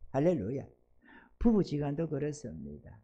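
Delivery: normal talking voice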